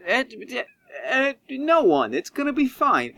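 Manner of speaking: a liar caught off guard; flustered Doctor Cook. Acted. Doctor Cook dodging the question, high and flustered.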